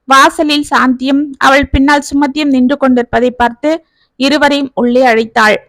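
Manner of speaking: ordinary delivery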